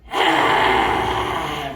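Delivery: loud open-mouthed lion's roar exhale. Shown as a demonstration. Teacher shows kids lion's breath with a loud roar. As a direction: roar it out from the belly, tongue down. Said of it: "tongue down".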